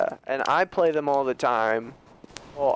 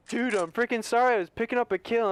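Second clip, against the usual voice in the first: higher-pitched imitation of a teammate's apology; raised-pitch retold protest; mock defensive excuse; 7 semitones higher than usual. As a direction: plead an excuse, imitating your friend.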